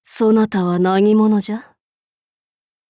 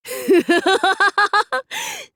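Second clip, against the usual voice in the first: high, sneering demonic laugh; evil cackle; 10 semitones higher than usual. Acted. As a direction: cackle down at the enemy.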